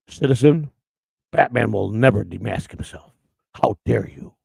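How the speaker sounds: slow, solemn mock superhero; pompous caped-hero imitation; Batman. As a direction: declare it gravely, low and measured. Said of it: "dress up a, a Batman".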